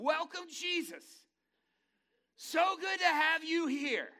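high, loud, slow sugary host voice; a hammed-up devil playing nice; mock-welcoming Satan. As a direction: greet him like a smarmy host at the gates. Satan welcoming Jesus, acted.

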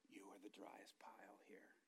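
very soft, near whisper; hushed divine reminder. Retold or imitated. The Lord reminding the preacher of his dry pile, hushed. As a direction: say it low and hushed, almost under the breath.

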